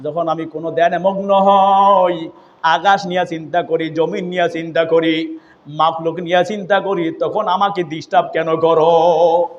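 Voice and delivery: ordinary talk, nothing marked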